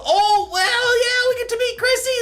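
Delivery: high, loud excited squeal. Real, not acted. Streamer greeting new viewer Chrissy, high excited squeal. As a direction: squeal with delight.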